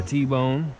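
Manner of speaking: ordinary talk, nothing marked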